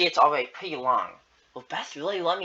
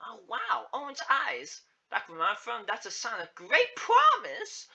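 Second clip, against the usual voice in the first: gawking amazement; 9 semitones higher than usual. Acted. Agnes seeing Zeke's orange eyes, gawking. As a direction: marvel in a much higher voice. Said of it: "she gawked".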